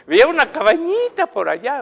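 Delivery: lamento agudo y fuerte, de imitación; llanto imitado. Retold or imitated. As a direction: imita su relato lloroso, subiendo la voz. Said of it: "y lo decía llorando". (llorando de imitación)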